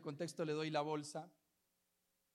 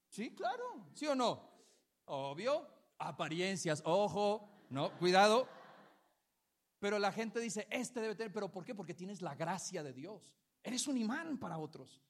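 loud, higher preaching at full force; fiery preaching; 3 semitones higher, 7 dB louder than usual. Acted. Pastor urging the church about God's grace, fervent preaching. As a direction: stir up the congregation with rising fervour.

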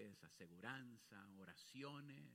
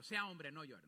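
mock stern command; 3 semitones higher, 11 dB louder than usual. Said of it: "No, no le dije eso, más estoy bromeando".

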